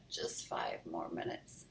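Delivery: low, slow drowsy whisper; sleepy whisper. Acted. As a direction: plead for sleep half asleep. Sleepy child mumbling.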